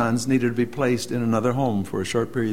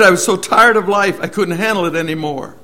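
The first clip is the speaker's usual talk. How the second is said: raised, higher, louder cry of despair; a retold man's desperate confession, half cried out; anguished outburst; 5 semitones higher, 7 dB louder than usual. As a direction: pour out the despair, raised and loud. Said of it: "But he said".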